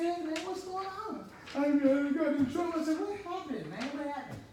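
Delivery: high-pitched, animated acted exchange; retold excited greeting. Two men meeting in jail, animated high voice. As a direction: pitch it up, re-enacting eager jail chat.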